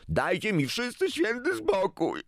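very high, strained outcry without full volume; exasperated cry. A narrator's reading. Boy fleeing the family quarrel, exasperated cry. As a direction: cry out as if at breaking point. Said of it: "zawołał Paweł i wybiegł z pokoju".

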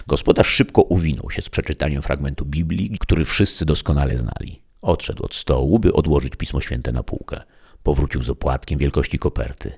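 usual speaking voice

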